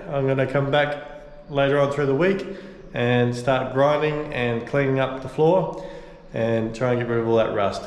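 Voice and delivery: normal talking voice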